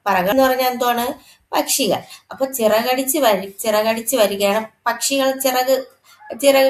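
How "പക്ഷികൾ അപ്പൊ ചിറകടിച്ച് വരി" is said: normal talking voice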